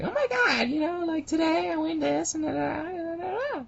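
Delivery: put-on very high, ditzy cam girl; high girly voice. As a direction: squeal it like a vapid streamer. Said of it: "they'll be like".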